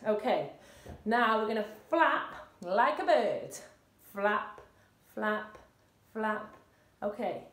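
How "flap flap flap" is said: slow, evenly spaced flaps with pauses; slow flapping. Shown as a demonstration. Coach leading class in bird flaps, slow and steady. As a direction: call each flap separately, unhurried. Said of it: "start off a little bit slower".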